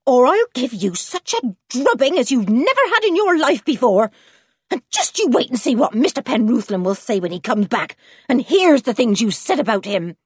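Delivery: irate farmer's wife; trembling anger. A narrator's reading. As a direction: threaten a thrashing in an angry rush. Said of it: "trembling with anger".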